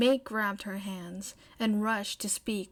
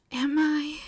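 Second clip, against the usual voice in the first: stunned whisper; 5 semitones higher, 5 dB louder than usual. Acted.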